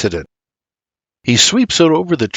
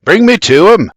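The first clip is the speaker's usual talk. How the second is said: raised, louder order; urgent command; 7 semitones higher, 6 dB louder than usual. A narrator's reading. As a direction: order them firmly. Doc gives an urgent order.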